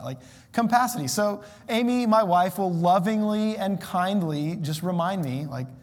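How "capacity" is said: compacity with an added m; compacity, the tic version; mispronounced compacity. Shown as a demonstration. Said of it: "I've heard you say that".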